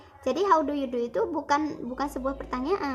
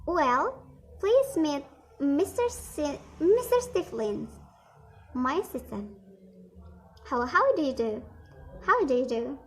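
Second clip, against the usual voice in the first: dialogue read aloud; 3 semitones higher than usual. Shown as a demonstration. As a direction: read each role clearly for the class. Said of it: "[name] contohin lagi ya". (membaca dialog bahasa Inggris perlahan)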